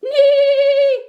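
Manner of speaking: high, loud whinny; horse neigh. A narrator's reading. Horse answers Duck's hello, neighing loudly. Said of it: "said Horse".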